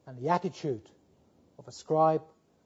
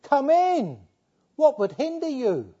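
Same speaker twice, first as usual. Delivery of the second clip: higher, slow pleading invitation; father entreating his elder son, higher and pleading; 8 semitones higher, 7 dB louder, slower than usual. Acted. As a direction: coax him in with your arm round him. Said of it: "he puts his arm around him and he entreats him".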